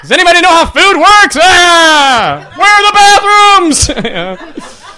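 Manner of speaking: high, loud bellowed questions; hammed-up high holler; mock clueless yelling. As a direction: yell it like a baffled loudmouth. Mock-ignorant customer yelling questions.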